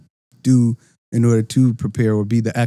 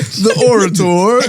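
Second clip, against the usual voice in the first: laughing; 9 semitones higher, 4 dB louder, slower than usual. Real, not acted. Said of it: "I don't even know the word".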